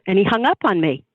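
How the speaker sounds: ordinary talk, nothing marked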